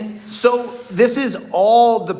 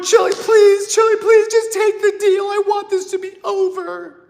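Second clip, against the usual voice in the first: much higher, louder wailing plea; crying plea; 14 semitones higher, 4 dB louder than usual. Retold or imitated. Crying mom begging Chili to take the deal, high wailing plea. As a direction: beg tearfully like a desperate relative. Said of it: "and they're crying".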